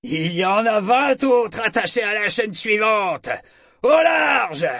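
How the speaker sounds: high, loud roar climbing to a shout; roared threat. A narrator's reading. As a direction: warn the other ship off at full voice. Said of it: "rugit le commandant du Cicerne". (menace rugie, de plus en plus aiguë)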